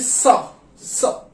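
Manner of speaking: सा whispered with a hissing s; snake hiss sa. Shown as a demonstration. Teacher demonstrating German ß as a hiss. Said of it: "सांप वाली sound आती है".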